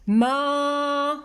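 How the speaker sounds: دماغ in drawn-out, separated pieces; slow dictation of the syllables. Shown as a demonstration. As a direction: hold ما long on a level note. Writes word syllable by syllable.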